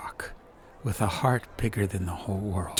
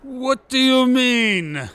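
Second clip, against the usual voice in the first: high, loud, slow indignant question; defensive; 8 dB louder, slower than usual. Acted. Duroc accused of reciting, a high loud defensive retort. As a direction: bristle and push back hard. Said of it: "asked Duroc defensively".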